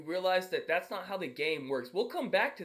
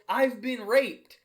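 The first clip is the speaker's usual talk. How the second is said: dramatic clickbait title; 6 semitones higher, 8 dB louder, slower than usual. Retold or imitated. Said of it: "the title of the video".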